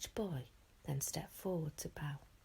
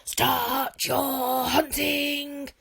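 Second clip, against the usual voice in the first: long drawn-out roaring command; yelled; 8 semitones higher, 14 dB louder, much slower than usual. A narrator's reading. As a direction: bellow the start of the contest. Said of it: "yelled Gobber the Belch".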